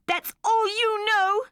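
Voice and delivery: put-on high, loud, slow child's defiance; a girl shouting before running out; angry shout. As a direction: fling it back at her mother. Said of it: "shouted Mandy".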